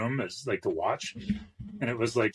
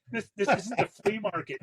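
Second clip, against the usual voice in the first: retold quote; 9 semitones higher than usual. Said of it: "Or like".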